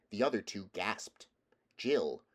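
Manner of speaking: plain reading voice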